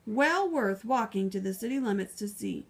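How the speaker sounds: ordinary delivery, nothing marked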